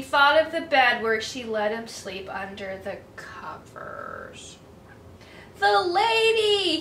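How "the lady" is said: slow, higher, louder call. Acted. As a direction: cry out for her into the rain. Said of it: "he called".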